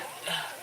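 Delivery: quiet, heavy sigh; exasperated sigh. Real, not acted. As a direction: let out a weary breath before complaining. Annoyed, starting a complaint.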